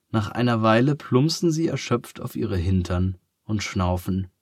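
ordinary delivery

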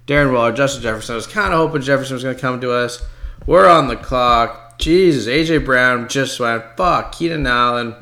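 normal talking voice

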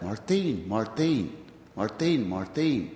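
imitated Spanish-accented chant, mar-THEEN; children crying out. Village children calling the visitor, imitated Spanish-accented cry. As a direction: chant "Martin" with a stressed, falling second syllable, Spanish accent. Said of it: "So the children cried out".

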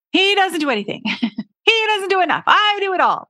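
hammed-up outrage at her husband; retelling her blame story, mock exasperated whine; fed-up complaining. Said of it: "the stories that I told myself".

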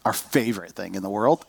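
dry, understated sarcasm; sarcastic aside about family traffic. Real, not acted. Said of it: "He said sarcastically".

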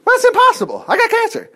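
high whiny doubter, well above his voice; mock doubter. Preacher mocking a believer who says it's impossible. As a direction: mimic the doubter's complaint up high.

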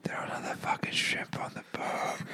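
unvoiced whispered Australian line; whispered Aussie slogan. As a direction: breathe the catchphrase.

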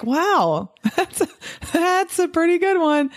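high, laughing wow at a clever insult; amused laugh. Pretend. Doctor laughing off a patient's insult, high and amused. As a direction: chuckle and admire the jab.

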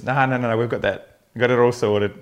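breezy confident patient. Retold or imitated. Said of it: "And her response was".

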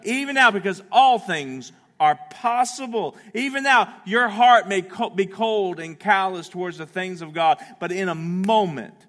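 loud, high, urgent preaching; full-force preaching. Real, not acted. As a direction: preach it out to the congregation.

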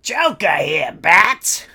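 mock villain hello; Joker impression. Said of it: "comic book stuff".

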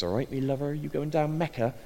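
imitated South Bristol accent; Bristolian accent. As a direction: greet a local in broad Bristolian. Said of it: "speaks with a thick Bristolian accent and says".

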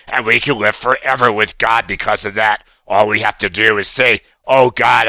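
lightly voiced puppet boy praying earnestly; a hint of a cartoon puppet leading a prayer; fervent little puppet prayer; Oscar praying. Puppet Oscar leads sinner's prayer.

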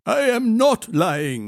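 high, slow, precise correction; correcting. A narrator's reading. Child correcting the boy's grammar, pointedly. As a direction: set the grammar right, stretching the words. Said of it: "Cow corrected".

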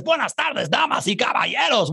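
high, flourished Spanish announcement; Spanish, called out like a ringmaster; showy Spanish greeting. Retold or imitated. Host reading a viewer's roll-call message, showy Spanish.

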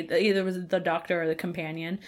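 ordinary delivery

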